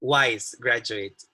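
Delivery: Wise stretched out slowly and leaned on hard; slow down and punch Wise, let graduate fall away; emphasis on wise. Shown as a demonstration. Lecturer spelling out her key word to students, slow stress on Wise. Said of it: "let me emphasize the word wise".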